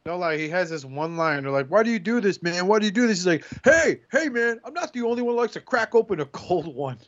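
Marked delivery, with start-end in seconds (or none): 1.7-3.2 retold interrogators. Acting out interrogators questioning a suspect. 3.6-7.0 high-pitched mock protest; hammed-up high, defensive suspect; imitated suspect. Acting out suspect's line from a show.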